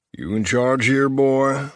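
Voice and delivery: lightly voiced drawling movie lawman; a hint of a put-on movie-style drawl; movie cop drawl. Cop sizing up the camp leader, a light put-on drawl. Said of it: "Vevermy drawled in an accent and tone".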